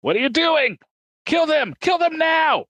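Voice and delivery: very high, strident villain tirade; furious villain command. Acted. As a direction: order it in an outraged, high-pitched mock shout. Intercom villain orders a killing.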